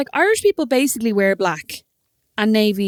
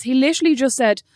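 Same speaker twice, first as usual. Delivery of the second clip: ordinary talk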